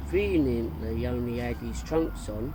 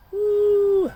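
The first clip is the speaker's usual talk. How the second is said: shrill excited woo; high whooping cheer; 13 dB louder than usual. A narrator's reading. Everyone cheers Fred's Adonis moment with a whoop.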